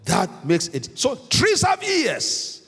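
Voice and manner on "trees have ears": "trees have ears" pitched high and drawn out; slow down and lift "trees have ears" high; stressed key point. Real, not acted. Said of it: "That's what I'm stressing".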